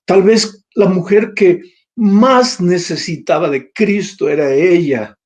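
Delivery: ordinary delivery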